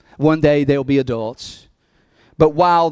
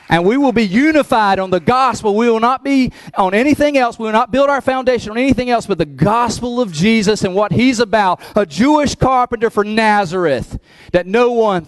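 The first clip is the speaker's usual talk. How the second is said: louder, rising preacher cadence; preaching at full force; 8 semitones higher, 4 dB louder than usual. Acted. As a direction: build it up like a sermon climax. Preaching climax.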